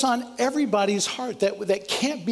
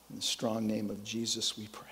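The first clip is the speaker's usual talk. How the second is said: whispered close; 11 semitones lower, 11 dB quieter than usual. Acted. Prayer's closing words.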